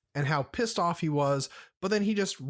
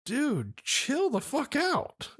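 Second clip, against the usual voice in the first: much higher and drawn out, half laughing; mock exasperated; 10 semitones higher, slower than usual. Cousins telling a raging player to calm down, mock exasperated. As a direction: urge him to calm down, with disbelief. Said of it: "and we're like".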